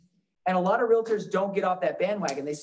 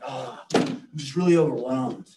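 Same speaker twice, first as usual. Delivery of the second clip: low, sighing mock overwhelm; mock overwhelmed guy; 5 semitones lower than usual. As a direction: imitate a guy feeling swamped, pitched down. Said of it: "Or the guy's like".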